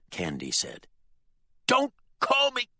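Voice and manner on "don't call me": high, loud shout, cut short; enraged father shouting; furious rant. A narrator's reading. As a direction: yell it in fury and break off. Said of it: "His fury echoed".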